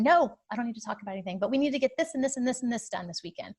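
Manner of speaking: impatient, hurried list of demands; rushed frustrated reply. Retold or imitated. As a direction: fire off the to-do list in a rush. Her frustrated reply to husband.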